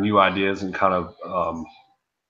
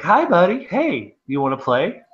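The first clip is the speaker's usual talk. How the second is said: put-on high-pitched parent greeting a little kid; playful, high kid-directed voice; talking to kids; 7 semitones higher than usual. Shown as a demonstration. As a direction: pitch it up to a small child's level. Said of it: "you naturally wanna kind of talk to their level".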